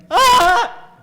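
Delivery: very high, loud scream of panic; panicked scream. Retold or imitated. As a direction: shriek in sudden alarm. Panic at baby coming.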